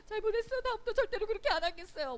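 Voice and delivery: high-pitched, hurried apology; acted contrite promise. Acting out a promise to parents. (높고 빠른 목소리로 다짐하며 비는 연기)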